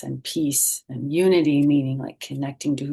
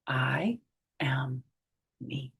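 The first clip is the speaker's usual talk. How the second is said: whispered; 3 semitones lower, 7 dB quieter, much slower than usual. A narrator's reading. Hushed reading of the book.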